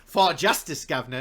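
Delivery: mock cockney battle cry, loud; hammed-up cockney knight. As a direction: shout it in a cockney accent. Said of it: "and you're just kinda like".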